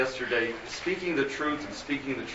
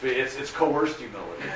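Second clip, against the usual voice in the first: ordinary delivery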